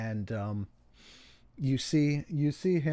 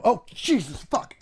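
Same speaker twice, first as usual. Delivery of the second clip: high, loud exclamation; startled cursing; 11 semitones higher, 8 dB louder than usual. Acted. Game master voices a man amid crashing metal, startled loud curse. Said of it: "you hear him go".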